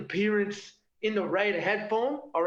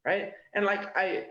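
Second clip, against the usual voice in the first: ordinary delivery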